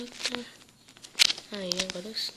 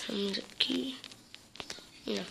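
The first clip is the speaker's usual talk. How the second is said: whisper; 5 dB quieter than usual. Real, not acted. Quiet aside while playing.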